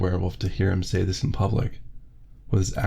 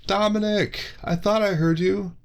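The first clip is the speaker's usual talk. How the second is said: much higher, cheery friendly guy; Brad's voice; 12 semitones higher than usual. Acted. Brad greeting Dominic from behind, high and cheery. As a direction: call out to a buddy you spotted, pitched up.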